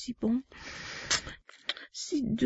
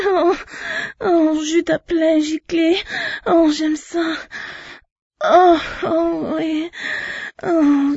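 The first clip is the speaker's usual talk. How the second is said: higher and louder, moaning; loud urgent moaning; 6 semitones higher, 6 dB louder, slower than usual. Acted. Urging her partner to climax, loud ecstatic cries.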